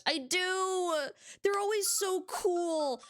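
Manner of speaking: high, excited gush; high-pitched excited fangirling. Real, not acted. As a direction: squeal your love for them. Gushing about goths.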